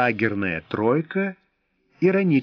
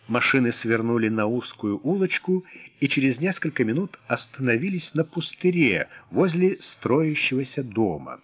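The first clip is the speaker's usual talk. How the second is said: ordinary delivery, nothing marked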